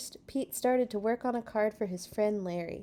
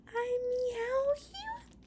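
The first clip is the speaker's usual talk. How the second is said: very high, slow kitty voice; cute cat voice; much slower than usual. Acted. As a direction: coo it like a sweet kitten. Reads cute card line in cat voice.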